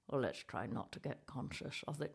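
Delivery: ordinary talk, nothing marked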